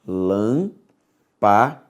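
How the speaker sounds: lâmpada split slowly into lâm-pa-da; syllable by syllable. Shown as a demonstration. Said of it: "nós temos isso aqui, ó".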